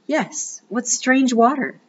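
high wondering princess voice; cartoon princess. A narrator's reading. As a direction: marvel like a little princess. Princess marvels.

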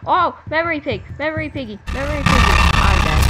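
loud frantic shouts, voice up high; panicked yell. Real, not acted. Spotted by enemy, yelling.

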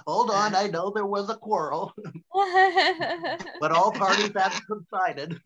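hammed-up polite talk to a cop.

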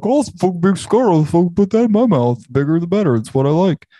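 high, excited dumb-guy voice, a bit fast; mock the big dumb cigar buyers; mocking dumb smoker. Host mocking dumb buyers, high goofy mock voice. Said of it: "They're like".

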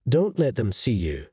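ordinary delivery, nothing marked

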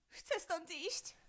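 scared murmur. Acted. Scared girl repeating.

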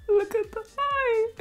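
very high, squeaky laugh-crying; crying with laughter. Real, not acted. To viewers, laughing so hard she cries. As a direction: wheeze the words through tears of laughter. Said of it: "I'm crying because I'm laughing".

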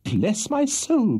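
high, drawn-out shocked exclamation; flabbergasted gentleman; astonished cry. A narrator's reading. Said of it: "cried the astonished Mister Pickwick".

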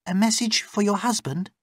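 very high, puzzled query; high female character voice, questioning; questioning servant woman. Acted. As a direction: check what she means, pitched high. Layla questions captive.

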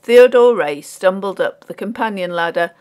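plain reading voice

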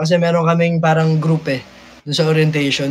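normal talking voice

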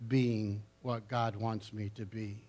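ordinary delivery

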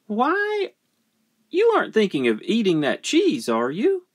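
put-on high, quick suspicious child; high-pitched accusing question; child questioning dog.

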